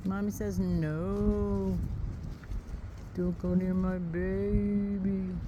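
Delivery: slow, soft protective mama cow; mother cow voice. Pretend. Farmer lightly voicing the mother cow guarding her calf, slow and soft. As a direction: speak for the mama, lightly, slowly.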